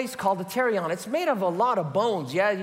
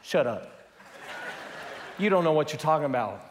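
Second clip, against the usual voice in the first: lower, hushing dismissal; dismissive shush; 4 semitones lower, faster than usual. Retold or imitated. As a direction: shut the critic down. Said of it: "I'm like".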